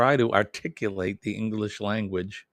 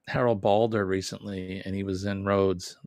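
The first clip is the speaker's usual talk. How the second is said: ordinary delivery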